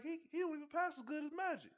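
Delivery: high squeaky mock voice; mocking the boss. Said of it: "he was like".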